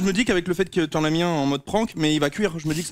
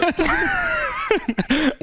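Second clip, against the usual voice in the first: squealing pained laugh; high squealing laugh. Real, not acted. Pepper pain, high laugh.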